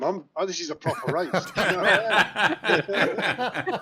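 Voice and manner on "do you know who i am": slow, laughing do-you-know-who-I-am; laughing mock rant. Friends quoting the viral meme, slow and laughing. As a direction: drag it out through laughter. Repeating Pickering line laughing.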